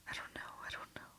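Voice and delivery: fast, frightened repeated whisper; anxious rapid muttering; panicked. Acted. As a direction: rush it out in a panic. Panicking pilot repeats herself.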